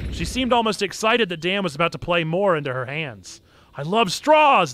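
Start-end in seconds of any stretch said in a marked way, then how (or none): 3.8-4.7 loud, higher proud declaration; proud proclamation. Acted. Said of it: "proudly proclaimed".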